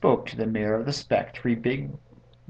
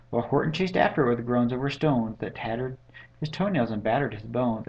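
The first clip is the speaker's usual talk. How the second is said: ordinary delivery, nothing marked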